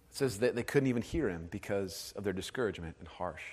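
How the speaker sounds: hushed. Real, not acted. Pastor dwelling on the people's despair.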